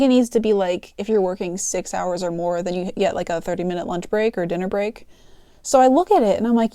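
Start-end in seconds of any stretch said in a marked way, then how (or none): none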